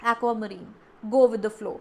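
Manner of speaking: ordinary delivery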